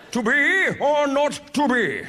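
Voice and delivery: slow, grand Shakespearean declaiming; fancy Ren Fair language. Acted. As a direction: declaim it like a hammy stage Hamlet. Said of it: "in that fancy Ren Fair language".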